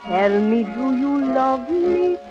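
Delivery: ordinary talk, nothing marked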